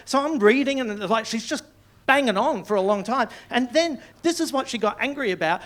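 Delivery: usual speaking voice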